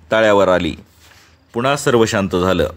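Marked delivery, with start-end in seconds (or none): none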